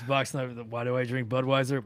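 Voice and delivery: usual speaking voice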